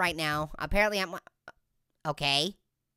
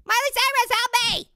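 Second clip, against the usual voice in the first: mock cry for help; 12 semitones higher, 3 dB louder than usual. Calling out for help.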